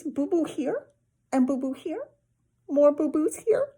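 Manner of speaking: put-on high toddler voice. A narrator's reading. Toddler showing his brother his scrapes. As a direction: show off scrapes like a little kid.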